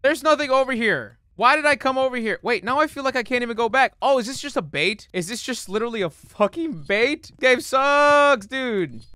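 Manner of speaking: high, loud griping; frustrated outburst. Real, not acted. Player stuck at a dead end, frustrated. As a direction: yell it in mounting exasperation.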